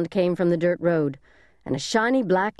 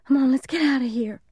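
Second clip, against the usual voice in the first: put-on high child's voice, a touch of crying; tearful young boy; 7 semitones higher than usual. A narrator's reading. Frightened little brother urging big brother to leave, mildly tearful. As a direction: plead high, like a kid near tears. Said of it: "started crying".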